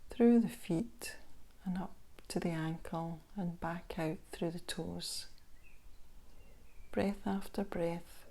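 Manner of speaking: unhurried body-scan guidance with long pauses; guided meditation. Acted. Instructor guiding listeners through a body scan meditation, calm guided meditation voice. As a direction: ease the listener into stillness, leaving space between phrases.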